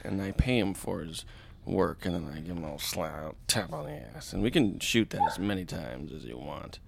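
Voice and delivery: lightly voiced creepy actor, low and soft; a hint of creepy, hushed storytelling; Kevin Spacey. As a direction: pitch a film idea, quietly insinuating. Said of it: "that's my Kevin Spacey impression".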